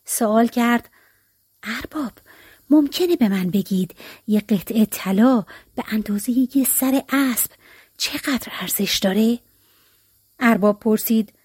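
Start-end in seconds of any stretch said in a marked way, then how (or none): 1.6-9.4 secretive near-whisper; hushed, sly. A narrator's reading. Peasant alone with the master asks about gold, hushed. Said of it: "اونم آهسته".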